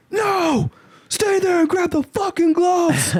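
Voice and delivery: high, slow mock yell; retold scream. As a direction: scream the challenge to fight. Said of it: "I'm in a restaurant screaming this".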